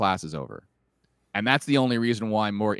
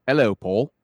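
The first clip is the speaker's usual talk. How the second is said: Scouse catchphrase to get into the accent; attempted Liverpudlian greeting; 4 dB louder than usual. Shown as a demonstration. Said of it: "the only way I've ever able to get in is like".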